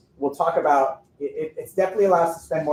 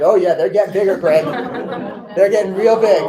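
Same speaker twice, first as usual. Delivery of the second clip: louder, breezy fake-confident reassurance; mock confident reply; 3 semitones higher, 6 dB louder than usual. Orthodontist to worried banker, loud mock-confident bluff. Lying to bank about numbers.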